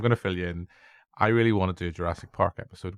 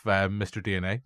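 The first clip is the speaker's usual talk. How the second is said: ordinary delivery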